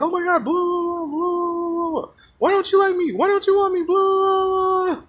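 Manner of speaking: very high, wailing mock voice; mock whining. Rejected guy begging the girl, high blubbering mock whine.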